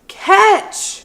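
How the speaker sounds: high loud yelled catch; a man yelling from a truck at a woman walking alone; retold scream. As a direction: yell it from a moving truck. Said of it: "holds up a football and screams".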